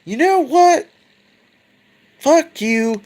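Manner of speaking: high, indignant outburst; furious father. Retold or imitated. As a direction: spit the curse at his son. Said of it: "he's like".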